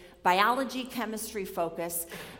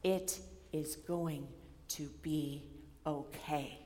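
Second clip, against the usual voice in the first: very slow, soft and low; tender reassurance; 5 semitones lower, 9 dB quieter, slower than usual. Shown as a demonstration. Preacher voicing Jesus comforting the worried, slow and soft. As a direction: let each word land gently. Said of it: "Jesus saying".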